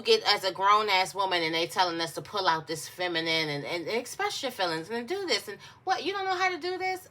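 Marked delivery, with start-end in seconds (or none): 5.8-7.1 much higher, fast mocking question; mock them with a squeaky sneer; mocking disbelief. People mocking a grown woman for not expressing feelings, high mocking sneer.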